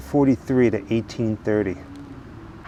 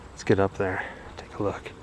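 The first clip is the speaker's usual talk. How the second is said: whispering; 6 semitones lower, 13 dB quieter than usual. Real, not acted. Hanging back near a passing tour.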